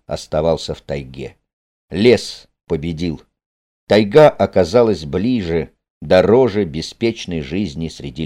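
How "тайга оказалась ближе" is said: ordinary delivery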